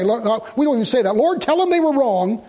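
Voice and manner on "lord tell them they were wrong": whiny believer. Retold or imitated. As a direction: mimic a tattling child asking God. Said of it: "Lord, was I right".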